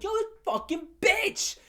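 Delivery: high-pitched exasperated cursing; wilding out. Retold or imitated. Student raging about the teacher who accused him, wilding out. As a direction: spit out the curse high, reliving the blow-up. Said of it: "that's when I started wilding the fuck out".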